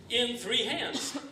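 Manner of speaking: slow drawn-out punchline. Acted. Deadpan absurd punchline.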